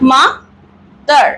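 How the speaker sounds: mother as ma, long pause, dar; split into two syllables. Shown as a demonstration. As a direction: break mother into two separate beats. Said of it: "আমরা দুটো সিলেবেলে উচ্চারণ করব". (mother শব্দটা দুই ভাগে থেমে থেমে)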